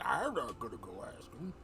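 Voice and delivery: put-on orc voice, refusing. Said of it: "He's like".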